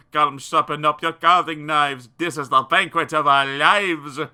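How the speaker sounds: loud, greedy mob shouting; excited shouting. Acted. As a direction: shout it like a hungry crowd. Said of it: "The French were shouting".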